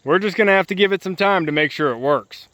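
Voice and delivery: ordinary talk, nothing marked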